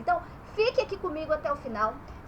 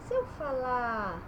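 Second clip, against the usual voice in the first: normal talking voice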